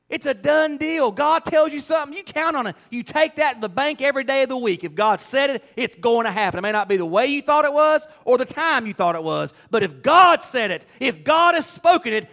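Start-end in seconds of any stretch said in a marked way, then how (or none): 0.1-5.6 high, emphatic pulpit declaration; forceful preaching. Real, not acted. Preacher assuring people of God's word, forceful preaching. 6.6-12.3 high, emphatic pulpit declaration; forceful preaching. Real, not acted. Preacher assuring people of God's word, forceful preaching.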